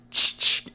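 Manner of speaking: sharp, loud unvoiced shotgun-racking sound; imitated shotgun pump. Telling viewers about the neighbor's shotgun, a loud unvoiced pump sound. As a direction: mouth the click of a shotgun being racked. Shotgun pumped before the escape.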